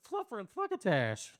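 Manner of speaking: high, spluttering cartoon cussing. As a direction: sputter it like a Looney Tunes character. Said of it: "Did I say we're Looney Tunes?".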